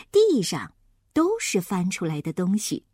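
ordinary delivery, nothing marked

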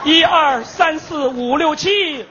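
slow solemn chanting fortune teller. Retold or imitated. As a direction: intone it like a mystic master. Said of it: "有一个算命的".

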